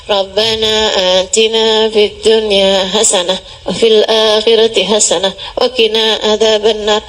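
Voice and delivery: low, droning recitation; mumbled-through prayer on one low note; unserious rote prayer. Acted. Preacher mimicking the listener's half-hearted prayer, low drone. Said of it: "Kalau kamu doanya".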